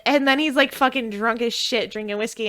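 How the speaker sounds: usual speaking voice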